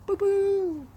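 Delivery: very high playful squeal; high squeaky cry. Acted. Playful exclamation.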